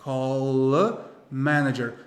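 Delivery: "call a" joined slowly as "caw-la"; slowed-down linked L in "call a"; slow linking. Shown as a demonstration. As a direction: stretch "call a manager", carrying the L onto the "a". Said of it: "esse L do call, ele vai se ligar".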